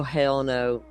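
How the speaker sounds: ordinary talk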